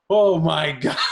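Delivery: retold stunned outburst with a laugh; amused disbelief. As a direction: react aghast, on the edge of laughing. Retold stunned reaction to the gimmick.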